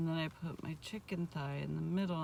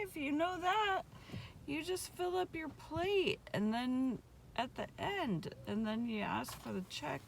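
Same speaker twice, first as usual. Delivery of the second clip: surprised; 7 semitones higher than usual. Retold or imitated. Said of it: "And I was like".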